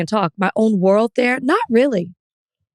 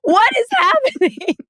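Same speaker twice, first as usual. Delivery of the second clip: stunned disbelief. Retold or imitated. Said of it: "And I'm like".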